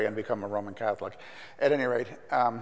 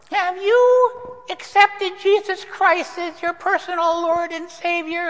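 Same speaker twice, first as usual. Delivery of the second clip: much higher, slow and louder, a mocking harsh Chicago accent; 9 dB louder, slower than usual. Preacher mimicking friend's mother questioning him in harsh Chicago accent. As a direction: drag out the pious question like a caricatured Chicago mother. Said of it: "a very harsh Chicago accent".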